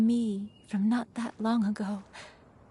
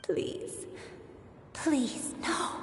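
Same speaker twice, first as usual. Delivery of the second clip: whispered plea; 3 semitones higher, 5 dB quieter, much slower than usual. Acted. Pleading as memory fades.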